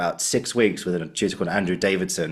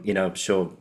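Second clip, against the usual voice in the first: ordinary delivery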